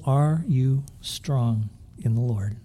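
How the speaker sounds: slow, pointed question; slow challenge. Real, not acted. Preacher slowly challenges the young people in the pews. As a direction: press the question slowly on them. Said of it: "preacher challenges young people".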